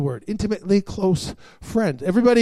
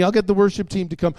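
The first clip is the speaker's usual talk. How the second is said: ordinary delivery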